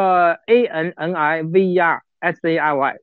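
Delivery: letters spelled one by one; spelling it out. Shown as a demonstration. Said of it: "那个是怎么拼的".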